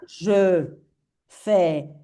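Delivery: "Je" rounded, then a pause before "fais"; closed JE. Shown as a demonstration. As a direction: purse "Je", separate it from "fais". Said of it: "Boca cerradita". («Je» con boca cerradita, separado de «fais»)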